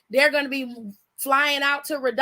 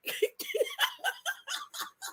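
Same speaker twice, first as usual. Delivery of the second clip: real helpless laugh, pitched way up; high wheezing laugh; 11 dB quieter than usual. Real, not acted. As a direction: lose it completely in a high wheeze. Hysterical laugh at the story.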